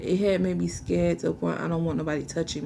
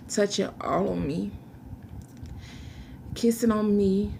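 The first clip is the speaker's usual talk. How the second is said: usual speaking voice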